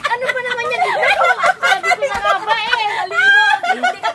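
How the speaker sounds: loud, high, laughing yell; laughing shout. Real, not acted. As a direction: shout while laughing hard. Soaked again, laughing.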